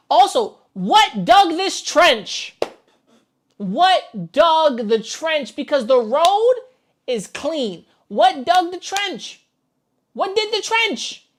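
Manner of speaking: loud, high, slow incredulous questioning; real loud bafflement, drawn out; baffled outcry. Real, not acted. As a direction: demand an answer in disbelief. Baffled by the snow trench.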